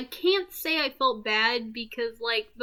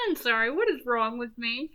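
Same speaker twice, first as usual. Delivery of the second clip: ordinary talk, nothing marked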